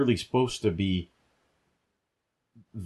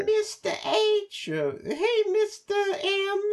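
much higher, slower squeaky cartoon greeting; mock goofy voice; much slower than usual. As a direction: squeak it out like a dopey sidekick. Mocking a silly character's greeting.

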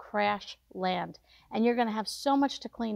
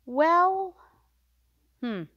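hesitant evasive reply; 7 semitones higher, 4 dB louder than usual. Retold or imitated. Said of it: "friend's hesitant reply".

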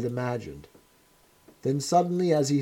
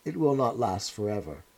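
softer, gentle reassurance; almost kindly; 5 semitones lower, 6 dB quieter than usual. A narrator's reading. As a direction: soothe him like a patient father. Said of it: "almost kindly".

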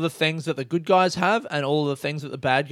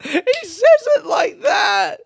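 cracking up, high and loud; slow words through a high laugh; laughing so much it squeaks; laughing hard; 7 dB louder, much slower than usual. Real, not acted. Laughing outburst.

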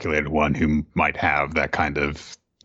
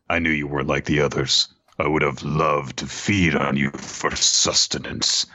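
amused monster; 4 semitones lower than usual. Acted. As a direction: savor the words like a predator toying with prey. Voices the kaiju number ten.

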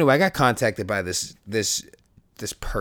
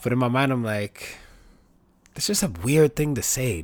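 ordinary delivery

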